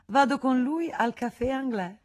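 high, breezy refusal; Rosanette's voice. Acted. Rosanette turns down Cisy's dinner invitation, high and breezy. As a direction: brush off the invitation lightly. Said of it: "rispose la marescialla".

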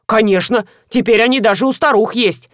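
ordinary delivery

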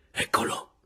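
whispered heads-up; hushed, voiceless warning; said softly. A narrator's reading. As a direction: whisper it to the person beside you. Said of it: "disse piano la Caporale".

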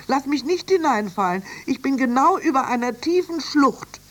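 high voice, insisting then growing anxious; protest, then plead to be held tight; high-pitched, urgent character voice; Annie, insistent then alarmed. Acted.

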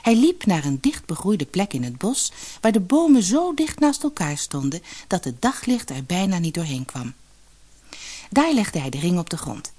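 ordinary delivery

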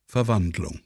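ruhig vorgelesene Bühnenanweisung; Regieanweisungen lesen. A narrator's reading. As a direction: sachlich die Szene ansagen. Liest Regieanweisungen zum Stück. (Erzähler liest die Szene vor, gelassen)

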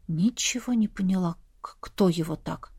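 low, hushed wondering; confused. A narrator's reading. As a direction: murmur it to yourself, puzzled. Narrator confused, thinking to herself.

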